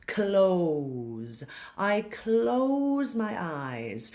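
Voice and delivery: slow, stretched "close" with a clear K to L; careful close. Shown as a demonstration. Teacher modelling "close" for singing students, slow and exact. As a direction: draw out "close" from K into a voiced L. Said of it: "right off the bat".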